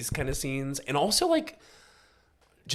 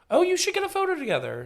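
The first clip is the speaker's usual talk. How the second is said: much higher, quick and bright; chirpy coworker; 5 dB louder, faster than usual. Retold or imitated. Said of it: "My coworker was like".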